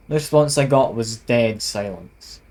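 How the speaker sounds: ordinary talk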